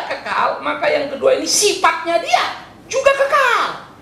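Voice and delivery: high, ringing pulpit proclamation; full-force preaching. Acted. Pastor preaching Christ eternal to the congregation, ringing.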